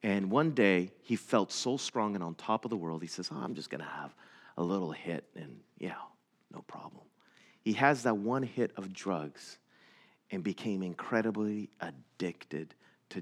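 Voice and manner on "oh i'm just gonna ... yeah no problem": casual quiet aside. Retold or imitated.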